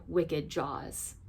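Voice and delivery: usual speaking voice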